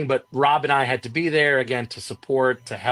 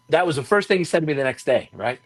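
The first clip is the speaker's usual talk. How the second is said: ordinary talk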